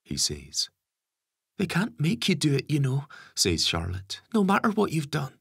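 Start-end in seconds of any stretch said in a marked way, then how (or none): 1.6-3.1 put-on higher young woman, insistent; daughter voice. 4.3-5.4 put-on higher young woman, insistent; daughter voice.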